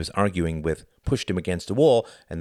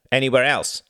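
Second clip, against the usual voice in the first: customs official; 7 semitones higher, 7 dB louder than usual. A narrator's reading. Stern customs official questioning.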